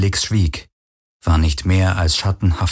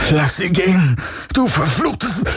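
much higher, furious outburst; 11 semitones higher than usual. Acted. Galen rises up against the creature, furious. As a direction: explode at the creature. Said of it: "sein Zorn weißglühend".

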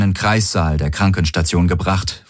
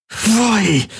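high, pressed-out cry in labor; straining through contractions. A narrator's reading. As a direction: force it out through the pain. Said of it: "presste sie zwischen den Krämpfen hervor".

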